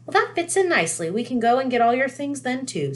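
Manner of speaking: high motherly voice; a cheerful mum; Mrs Weasley voice. A narrator's reading.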